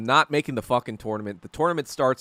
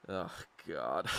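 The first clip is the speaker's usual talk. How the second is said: softer, low embarrassed groan; muttered dismay; 9 semitones lower, 14 dB quieter than usual. Retold or imitated. Standing aside while sister caught, quiet dismay. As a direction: mutter it under the breath.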